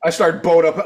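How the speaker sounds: normal talking voice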